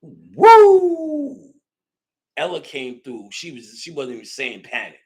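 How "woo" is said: loud, high falsetto woo; excited whoop. Real, not acted. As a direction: whoop with sudden joy. Excited memory of that night.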